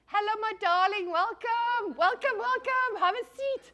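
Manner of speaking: high, loud, sing-song welcoming host; hammed-up high greeting to a newcomer; theatrical welcome. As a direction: greet the late arrival like a grand hostess. Welcoming someone arriving.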